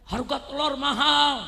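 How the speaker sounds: normal talking voice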